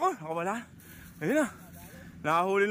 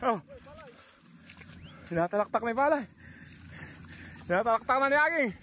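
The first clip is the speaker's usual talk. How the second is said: ordinary delivery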